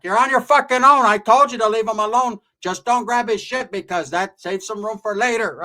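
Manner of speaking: high, loud acted telling-off; yelling aunt. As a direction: play the tia hollering from the sidelines. Aunt yells at choked nephew.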